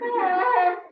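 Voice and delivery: high loud startled "Ah!"; stunned man gasps aloud; shocked gasp. Retold or imitated. Shocked businessman after being screamed at, high startled cry. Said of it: "the guy's over there going".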